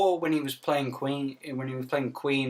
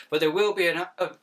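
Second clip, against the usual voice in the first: ordinary talk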